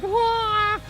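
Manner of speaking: sudden very high yelp of surprise; startled yelp. Retold or imitated. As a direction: squeal like a rider slamming the brakes. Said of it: "Steve put the brakes on, like".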